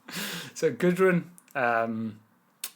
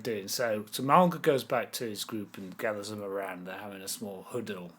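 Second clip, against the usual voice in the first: ordinary talk, nothing marked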